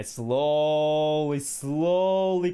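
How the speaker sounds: slow, dragging build-up of a crawl; drawn-out slow. Acted. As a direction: stretch each word out slowly.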